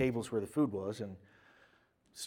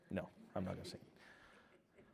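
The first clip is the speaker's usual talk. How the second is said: 1 muttered refusal; 7 semitones lower, 18 dB quieter than usual. Real, not acted. Declines audience request to sing.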